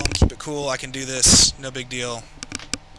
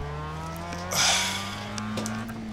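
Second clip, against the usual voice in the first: long weary sigh; 3 semitones lower, 7 dB quieter than usual. Real, not acted. Exasperated after being wrecked.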